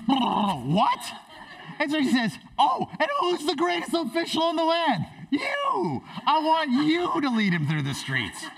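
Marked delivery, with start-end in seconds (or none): 0.1-1.1 high, mock-stunned yelp; stunned disbelief. Acting Haman's stunned reaction. 2.6-8.4 very high, hammed-up royal excitement; gleeful king. Acting king eagerly choosing Haman.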